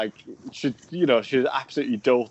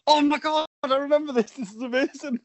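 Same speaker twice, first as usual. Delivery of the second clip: high, giggly burst of recognition; delighted recognition; 12 semitones higher than usual. Real, not acted. Co-host remembering the family story, high delighted outburst. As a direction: squeal it as the memory comes back.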